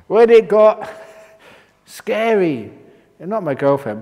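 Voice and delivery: ordinary talk, nothing marked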